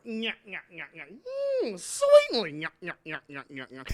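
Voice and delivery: Stooge nyah taunt. Acted. Curly character taunt.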